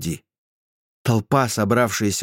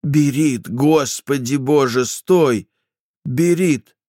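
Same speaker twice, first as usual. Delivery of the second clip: high frantic shouting; frantic shouting hostage taker; 5 semitones higher, 3 dB louder, slower than usual. Acted. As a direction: shout her back in panic. Ingri calls fleeing sergeant. (отчаянный крик)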